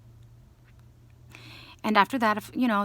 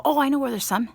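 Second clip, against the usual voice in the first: excited; 7 semitones higher, 6 dB louder, faster than usual. Retold or imitated. Rushing a friend to candy.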